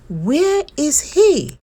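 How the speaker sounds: puzzled searching. Acted. Duck searching for hidden Bear.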